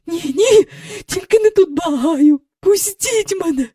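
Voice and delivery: high, near-crying begging; tearful pleading. A narrator's reading. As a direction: plead as if close to tears. Miss Andrew pleads in panic.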